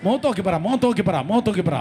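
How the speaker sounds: fervent revival preaching, rising to the top; full-force preaching. Acted. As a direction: preach it out over the crowd with fire.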